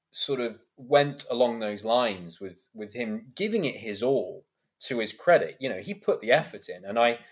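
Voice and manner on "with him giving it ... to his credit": ordinary delivery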